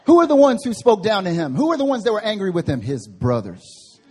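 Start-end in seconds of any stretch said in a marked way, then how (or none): 2.8-4.0 slow emphatic answer. Real, not acted. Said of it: "emphatic slow answer".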